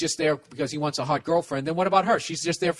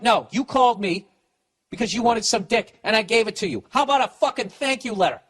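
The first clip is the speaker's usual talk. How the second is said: angry rant; 6 semitones higher than usual. Pretend. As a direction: lay into her like you're fed up. Acting out telling the woman off.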